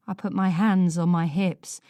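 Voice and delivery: ordinary delivery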